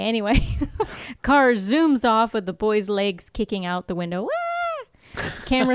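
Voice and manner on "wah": high-pitched comic "wah"; mock wail. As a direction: squeal like a kid being carried off, played for laughs. Voices boy kicking out of window.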